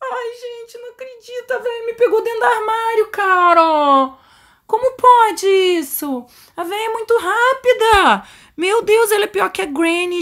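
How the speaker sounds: high-pitched wail of disbelief; dismayed wailing. Real, not acted. Gamer telling viewers she got caught, dismayed. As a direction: wail your complaint after getting caught. Caught by old woman, complaining.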